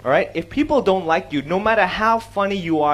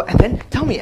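higher, impatient prompting; eager anticipation; 6 semitones higher, 4 dB louder than usual. Acted. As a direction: press eagerly for the punchline. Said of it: "then there's a tension".

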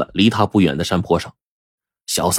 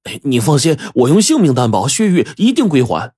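higher-pitched, emphatic promise; hasty assurance; 6 semitones higher than usual. A narrator's reading. Young man guarantees his friend the jade's return, urgently assuring. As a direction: swear it urgently so he will agree. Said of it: "就忙向阿石保证说".

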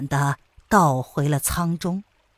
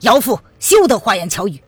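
loud, stern denunciation; stern, righteous rebuke; stern shout; 5 dB louder than usual. A narrator's reading. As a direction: denounce the villainess forcefully. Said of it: "厉声的道".

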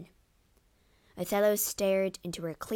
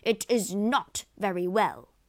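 loud, outraged howl; howling protest; 6 dB louder than usual. A narrator's reading. Rodrigo contradicts Lago, loud howling protest. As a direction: howl the contradiction back loudly. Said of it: "howled Rodrigo".

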